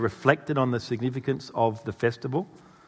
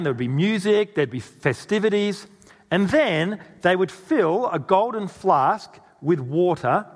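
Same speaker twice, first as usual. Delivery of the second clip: ordinary talk, nothing marked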